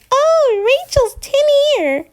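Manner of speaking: high, mocking singsong taunt; mocking jeer. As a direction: tease her like a scornful sibling. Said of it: "no one's here to say".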